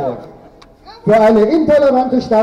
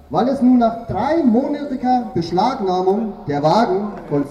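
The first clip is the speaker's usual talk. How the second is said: pompous formal speech; 6 dB quieter than usual. Acted. Mock official delivers satirical Festrede.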